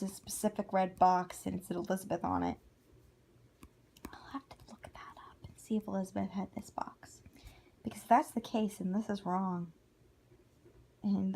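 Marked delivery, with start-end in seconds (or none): 4.1-7.2 near-whispered note to self; muttered aside. Real, not acted. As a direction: mutter it softly under your breath. Note to self while checking.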